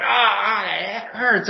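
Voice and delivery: high, whiny fake pain; mock whining. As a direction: ham up the pain. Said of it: "like, going".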